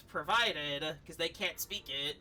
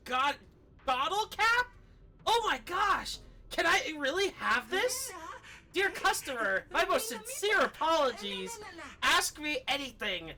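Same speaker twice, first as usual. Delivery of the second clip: higher-pitched, eager little shopkeeper voice; cute little robot shopkeeper; 6 semitones higher than usual. Acted. Streamer voicing the game's shopkeeper to a customer, higher, eager. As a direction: play a tiny excitable robot clerk. Reads Bangbu shopkeeper lines in voice.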